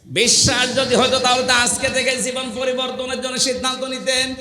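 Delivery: high, insistent sermon voice; forceful preaching. Real, not acted. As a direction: drive each point home to the crowd. (জোরালো ওয়াজের উঁচু গলা)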